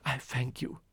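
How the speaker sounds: ordinary delivery, nothing marked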